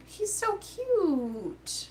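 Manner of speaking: high, very drawn-out coo of delight; high-pitched adoring gush; real gushing. Real, not acted. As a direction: melt over how adorable it is, stretching it out. Gushing over the mini dinosaur.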